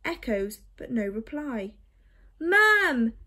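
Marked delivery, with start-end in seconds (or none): 2.4-3.1 louder shout. Acted. Boy calling his missing parents, louder shout. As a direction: call even louder, high and desperate. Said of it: "he shouted louder still".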